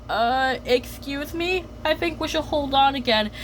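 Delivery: politely timid interruption; Nani's timid voice. Retold or imitated. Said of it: "Then Nani says".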